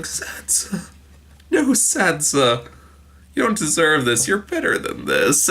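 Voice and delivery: high, hushed wail of sympathy; mock pleading lament. Viewer aching for a bruised Sansa, mock pleading lament.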